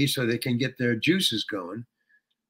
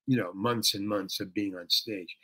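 ordinary talk

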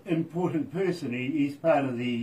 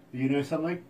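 normal talking voice

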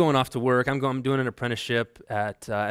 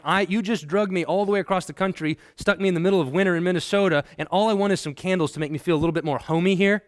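higher-pitched, rushing mock complaint; exasperated wife; 6 semitones higher, 3 dB louder than usual. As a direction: pile up the grievances as an exasperated wife. Voices wife's complaint in quarrel.

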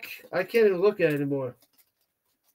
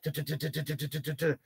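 soft sputtering engine noise from the tongue; motorcycle sound; 4 semitones lower, 14 dB quieter, faster than usual. Retold or imitated. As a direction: imitate a motorbike rattling along quietly. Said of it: "it sounds like I'm driving a motorcycle".

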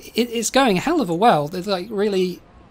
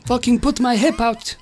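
put-on higher-pitched grumbling Italian old man; hammed-up protest from an old man; Da Vinci voice; 4 semitones higher than usual. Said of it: "he's like".

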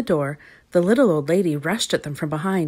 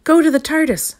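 loud, high-pitched command; urgent cry; 10 semitones higher, 6 dB louder than usual. A narrator's reading. The doctor escaping the supermarket with companions, crying out. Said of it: "cried the doctor as they escaped".

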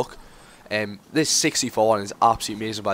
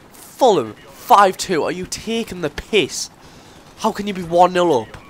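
raised, heated football rant; pissed off rant; 5 semitones higher, 4 dB louder than usual. Real, not acted. Fan ranting at his team's collapse, heated and raised. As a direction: vent frustration about the loss, pitch climbing. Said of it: "I'm really pissed off".

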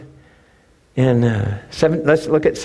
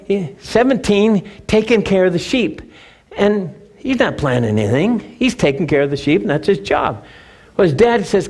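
usual speaking voice

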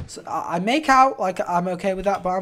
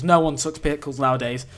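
ordinary talk

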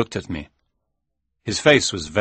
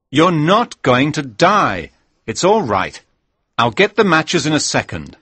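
raised, insistent reassurance; calm a panicking friend urgently, pitched up; 7 semitones higher, 4 dB louder than usual. Acted.

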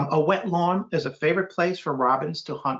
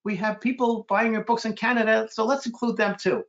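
higher-pitched reported speech; lightly imitated, higher voice; quoted publisher; 8 semitones higher than usual. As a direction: lightly voice a publisher making a suggestion. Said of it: "And my publisher said".

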